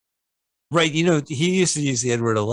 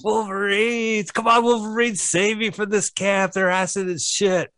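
overacted death scene; 9 semitones higher than usual. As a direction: wail it like a dying hero. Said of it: "He's like".

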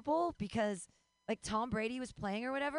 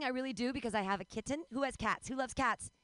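ordinary talk, nothing marked

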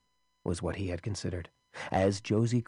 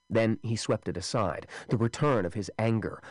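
usual speaking voice

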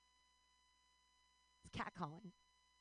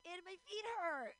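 high-pitched mock complaint; exaggerated high whine; comic whining; 9 semitones higher than usual. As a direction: whine it like a worn-out party girl. Retells her own drunken complaint.

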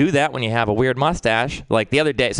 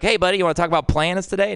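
put-on fast, higher, sing-song kid-friendly invite; mock chirpy invite; 8 semitones higher, faster than usual. Mocking the neighbor's idea of him inviting the kid to talk planets, fast and bright. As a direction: chirp it like a creepy friendly grown-up. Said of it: "why would I be like".

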